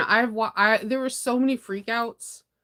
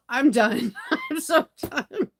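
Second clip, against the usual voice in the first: laughing; 6 semitones higher, 3 dB louder, slower than usual. Real, not acted. Laughing at real cicadas.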